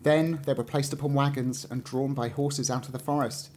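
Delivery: ordinary delivery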